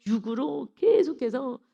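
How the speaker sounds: ordinary delivery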